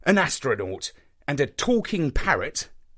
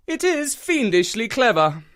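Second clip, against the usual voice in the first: grudging admission; 11 semitones higher, 3 dB louder than usual. Acted. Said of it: "admitted Holmes".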